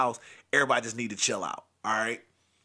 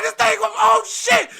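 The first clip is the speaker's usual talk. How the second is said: much higher, louder furious yell; cursing shout; 10 dB louder, slower than usual. Retold or imitated. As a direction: blow up at the flooding toilet. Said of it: "He's like".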